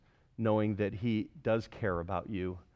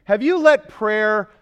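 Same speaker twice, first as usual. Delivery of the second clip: loud, driving sermon voice; fervent preaching; 7 semitones higher, 13 dB louder than usual. Acted. Pastor urging the congregation never to quit praying, loud and fervent. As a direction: press the congregation hard.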